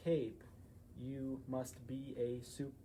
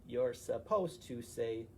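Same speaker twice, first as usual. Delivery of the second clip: ordinary delivery, nothing marked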